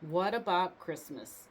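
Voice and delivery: ordinary delivery, nothing marked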